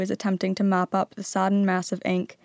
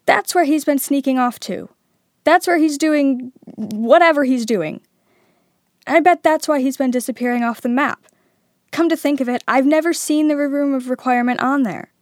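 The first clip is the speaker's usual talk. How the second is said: excited; 6 semitones higher, 7 dB louder than usual. A narrator's reading. Harry excited discovery.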